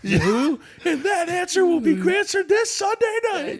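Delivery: hammed-up hype announcer voice; wrestling announcer. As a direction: prank caller hyping a wrestling event, high and laughing. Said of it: "he goes".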